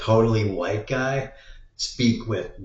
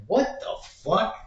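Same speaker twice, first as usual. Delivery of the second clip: startled what the fuck; 11 semitones higher, slower than usual. Pretend. Startled by stranger from flank.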